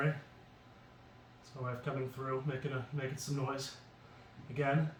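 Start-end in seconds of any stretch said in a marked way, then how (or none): 0.1-3.7 softer whispered apology; hushed aside. Real, not acted. Apologizing to viewers while his wife passes, hushed. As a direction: drop to a near whisper for an aside.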